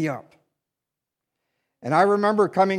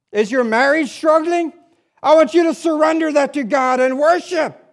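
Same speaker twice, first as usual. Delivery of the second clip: building altar call; 5 semitones higher, 3 dB louder than usual. Acted. Pastor calling the congregation to surrender, building to a cry. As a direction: build the appeal to a climax.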